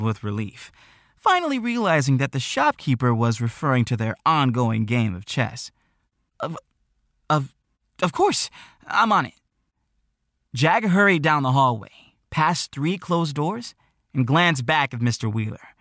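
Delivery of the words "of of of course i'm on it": flustered, stumbling higher start; tripping over the first words; nervous stammer. Acted. Nervous Jack caught off guard.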